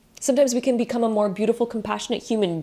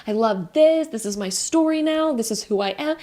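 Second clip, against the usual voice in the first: higher, eager and proud; excited; 7 semitones higher than usual. Retold or imitated. Answering someone who asks about you, gushing excitedly. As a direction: beam about your passion. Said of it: "you're just like".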